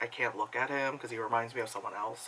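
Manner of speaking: low, soft, downcast; hushed retold heartbreak; mock heartbroken. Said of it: "I'm just, like, heartbroken".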